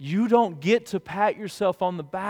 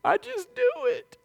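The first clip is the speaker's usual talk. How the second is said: put-on tearful child. Child in tears answering a parent, high helpless little voice. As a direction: answer like a little kid caught again. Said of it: "they'll say".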